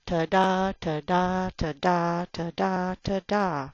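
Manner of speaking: ta-da chanted with the stress on da each time; drum beat rhythm. Shown as a demonstration. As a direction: tap out ta-da like a drumbeat. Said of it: "like a drum".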